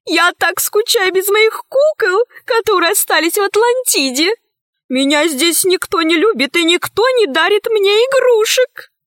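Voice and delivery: high, whiny lament of a pampered heiress; plaintive little-girl whine from a grown woman; childish self-pity. A narrator's reading. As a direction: pour out a childlike complaint in a high voice. Childish heiress complains about missing toys.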